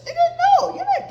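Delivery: high squealing laugh through no; laughing refusal. Real, not acted. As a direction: shriek the no with laughter.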